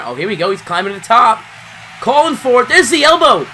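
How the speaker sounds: loud, high play-by-play count; wrestling commentator. Acted. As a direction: call the three count big. Calls the finish like play-by-play.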